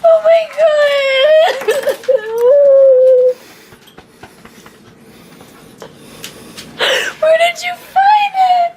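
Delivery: overwhelmed crying. Real, not acted. Overwhelmed by puppy surprise.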